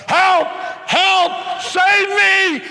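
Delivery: high, loud panicked yells for help; hollering for help. Acted. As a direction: scream for rescue as lions charge.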